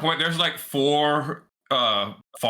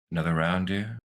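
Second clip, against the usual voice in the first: a hint of a voice carried on the wind; ghostly father's voice; 5 semitones lower than usual. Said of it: "you just hear in the wind".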